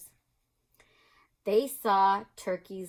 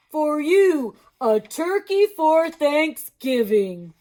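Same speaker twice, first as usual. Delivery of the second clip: slow, loud, friendly moose; 8 semitones higher, 12 dB louder, much slower than usual. Acted. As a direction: draw it out slowly and loudly. Mister Moose voice.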